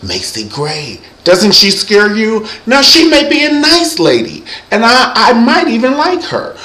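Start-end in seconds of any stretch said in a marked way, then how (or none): 1.2-6.6 high-pitched worry. Acted. Nervous comic bit about [name].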